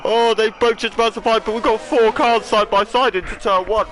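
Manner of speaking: high-pitched, urgent race call; excited commentary. Real, not acted. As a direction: keep the thrill up after a near crash. Commentator follows crash, four cars abreast.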